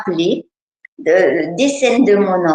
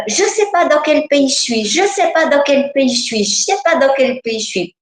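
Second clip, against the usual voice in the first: put-on higher, childlike and lost, repeating; a frightened child's voice, a little higher; little girl voice; 5 semitones higher than usual. Inner child to adult, lost and scared, childlike voice. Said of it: "cet enfant, il disait".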